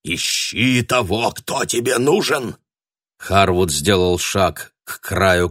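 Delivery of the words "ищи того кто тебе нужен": urgent shaman. A narrator's reading. Shaman urges Harwood.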